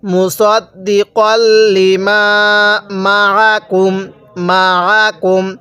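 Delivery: মাআকুম with the আইন pressed lightly in the throat, slow; slow correct tajweed reading; correct recitation. Shown as a demonstration. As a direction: recite মুসাদ্দিকাল্লি মা মাআকুম slowly and cleanly. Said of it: "তাহলে কিভাবে পড়তে হবে?".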